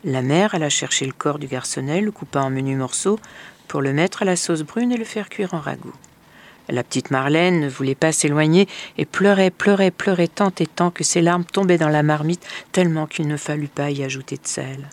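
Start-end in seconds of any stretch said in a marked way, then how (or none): none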